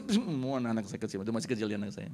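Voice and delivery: normal talking voice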